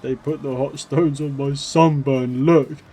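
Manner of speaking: whiny, pained complaint, rising at the end; a hint of whimpering as you show the burn; mock whimpering. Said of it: "kinda, like, whimpering".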